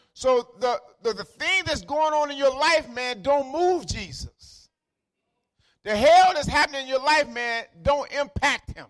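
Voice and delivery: high-pitched, driving sermon delivery; emphatic preaching. Acted. Preacher telling church their troubles don't move Jesus, emphatic preaching.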